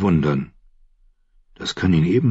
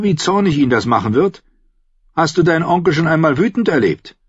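firm, raised voice, mounting in pitch; stern Davos, threatening; 7 semitones higher than usual. Acted. Davos scaring the boy into obeying, stern.

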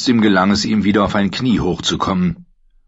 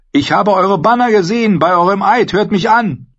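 high, loud urgent plea; pleading woman; 13 semitones higher, 5 dB louder than usual. A narrator's reading. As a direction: beg them to hear her. Brienne pleads with the knights.

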